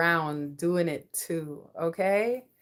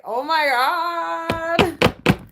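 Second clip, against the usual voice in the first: high, loud, drawn-out exclamation; mock amazed cry; 9 semitones higher, 8 dB louder, much slower than usual. Partner realizing what birds are, mock amazed cry. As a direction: stretch it out in a high, hammed-up cry.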